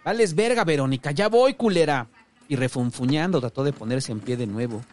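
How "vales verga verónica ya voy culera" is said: high, loud irritated yell; angry shout. A narrator's reading. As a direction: holler across the house at your wife. Yells to wife at the door.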